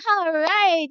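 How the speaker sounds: high, loud "all right"; big cheery encouragement; cheer. Real, not acted. Praising child.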